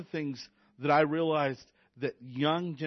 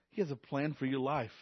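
ordinary delivery

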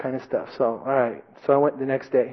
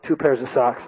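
ordinary talk

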